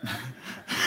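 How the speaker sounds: breathy chuckle; a quick, airy laugh at himself; soft laugh. Real, not acted. Pastor laughing about loving dessert, a soft chuckle.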